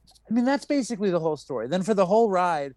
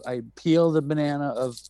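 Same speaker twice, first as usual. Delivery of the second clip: ordinary talk, nothing marked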